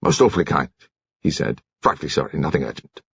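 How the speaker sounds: embarrassed commander. Acted. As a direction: mumble apologies like an awkward sailor. Narrator voices Seiss.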